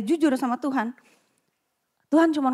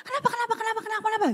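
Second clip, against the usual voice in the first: much higher, rapid, imitating a panicking husband; panicky husband; 10 semitones higher, 5 dB quieter, much faster than usual. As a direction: fire the questions off in mock panic. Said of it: "dia sepuluh kali lipat lebih panik".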